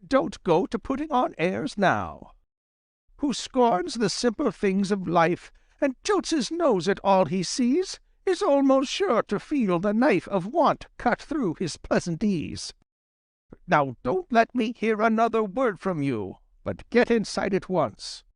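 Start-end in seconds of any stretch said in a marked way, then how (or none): 3.2-12.7 poem recitation. A narrator's reading. Verse motto read aloud. 13.7-18.2 put-on stern old fox mother. Granny Fox scolding Reddy.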